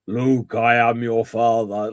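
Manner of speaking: slow, lightly deepened Vader line in a British voice; a hint of a booming Darth Vader, drawn out; James Earl Jones. Joking to the co-host about James Earl Jones, slow mock Vader. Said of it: "What did James Earl Jones sound like".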